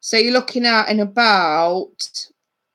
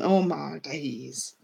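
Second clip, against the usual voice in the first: much softer, slow, low aside; amused disbelief; 5 semitones lower, 10 dB quieter, much slower than usual. Real, not acted. Streamer reacts to a viewer's messy love life, quiet disbelief. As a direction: let it sink in, quietly incredulous. Viewer juggling men she doesn't want.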